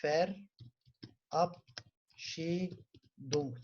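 Verabschiedung stretched out syllable by syllable; very slow model. Shown as a demonstration. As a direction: drag out Verabschiedung as a pronunciation model. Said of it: "Ne diyelim?". (Verabschiedung hece hece, çok yavaş)

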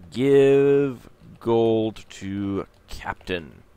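very slow, spelled-out command voice; typing command. Acted. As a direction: read the command as you type it. Reads typed game commands aloud.

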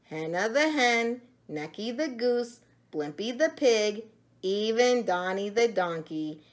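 ordinary delivery

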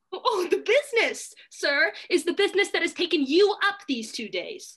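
pointed accusation. Acted. Maid accuses Dormant.

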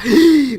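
high startled gasp at the sudden mobs; quick high gasp of alarm; sharp gasp. Real, not acted. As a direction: gasp in real surprise. Magma cubes suddenly appear.

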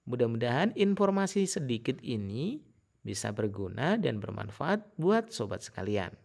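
ordinary delivery

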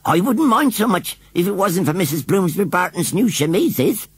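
upset village washerwoman; agitated washerwoman. A narrator's reading. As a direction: blurt it out in short agitated jerks. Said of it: "She spoke in short jerks".

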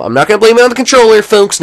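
high, rushed mock protest. Real, not acted. Gamer to viewers, refusing to blame the controller, mock protest. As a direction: rattle it off high and insistent.

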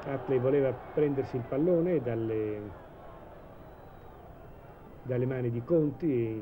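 ordinary delivery

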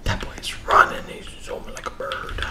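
hushed whisper while hiding. Real, not acted. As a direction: whisper so nobody hears.

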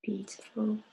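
a soft whispered approval; whispered praise. Acted. Meditation guide's soft affirmation.

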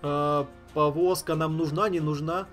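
ordinary delivery

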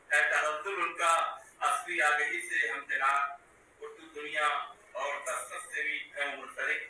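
measured mushaira recital, each line repeated; poem recitation. Acted. Poet reciting his tribute to a friend at a mushaira, measured poem recitation. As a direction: recite verse in deliberate, separated phrases.

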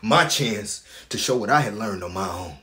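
loud, urgent outpouring; impassioned justification. Acted. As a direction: drive through it forcefully to the climax. Climax of justifying himself.

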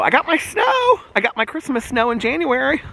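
high, sing-out delight; gleeful excitement. Real, not acted. Vlogger celebrates her Christmas snow in January, gleeful high voice. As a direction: crow about it up high.

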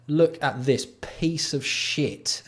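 slow, drawn-out scornful repeat; slow disgust. Real, not acted. Streamer drags out his scorn at a pricey bike pump. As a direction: stretch every word out in contempt. Mocking a five hundred dollar pump.

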